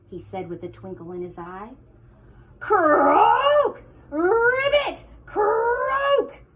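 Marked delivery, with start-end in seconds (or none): 2.6-6.4 loud, high croaking frog cries; toad croaks. Acted. Upset toad croaking in a hissy fit, loud and high. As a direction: wail like a furious toad.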